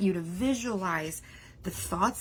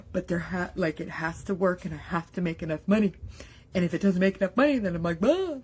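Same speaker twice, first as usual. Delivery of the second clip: mock money panic. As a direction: play the spiralling worry lightly. Voicing money anxiety.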